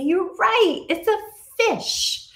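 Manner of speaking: high, slow, loud praise; praising teacher. Shown as a demonstration. Teacher praising a pupil's answer, high and slow. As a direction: cheer on a small child.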